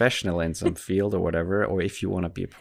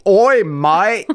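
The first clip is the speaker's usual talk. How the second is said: high, loud caricature British shout; mock British accent; 10 semitones higher, 10 dB louder than usual. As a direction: call out in hammed-up British. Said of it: "It used to be".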